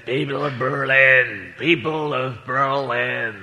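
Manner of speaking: slow, low orator voice; Kennedy speech. Retold or imitated. Comedian imitates Kennedy addressing Berlin, slow and solemn. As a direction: intone it like a grand speech. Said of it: "and he said".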